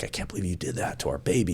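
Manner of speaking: quiet, fast, a touch of mock sobbing; retold weeping. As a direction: softly echo his broken-down plea, lightly.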